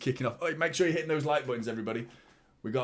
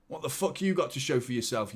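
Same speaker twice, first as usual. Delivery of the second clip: normal talking voice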